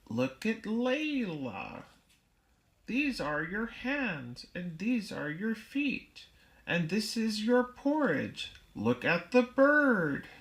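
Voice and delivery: put-on a light touch of a parent pointing things out to a baby; parent teaching a toddler. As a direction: coax a toddler to look and learn. Parent naming things for child.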